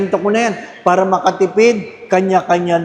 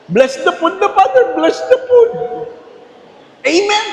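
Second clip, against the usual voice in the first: high, hurried squeaky prayer; greedy guest rushing the blessing, high and squeaky; mock rushed grace; 13 semitones higher than usual. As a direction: gabble grace to get to the food.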